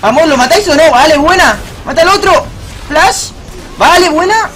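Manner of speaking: loud, high calls to teammates; real gamer hype, pitched high; urgent excited shouting. Real, not acted. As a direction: shout them on in the heat of battle. Urging teammates during a fight.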